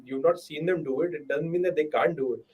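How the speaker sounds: ordinary delivery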